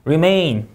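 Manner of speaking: "remain" with MAIN stressed; re-MAIN, "remain" ending in a fall; second-syllable stress. Shown as a demonstration. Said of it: "이음절 강세".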